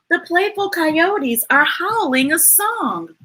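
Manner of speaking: lively sing-song picture-book reading; reading to a child. A narrator's reading. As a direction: read it bright and bouncy for a little one. Reading a rhyming picture book aloud.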